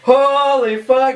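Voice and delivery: slow, drawn-out high exclamation; stunned driver. Pretend. Driver whose car God lifts, gasping in slow stunned awe. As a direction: gape in disbelief at the car lifting. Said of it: "they would just be like".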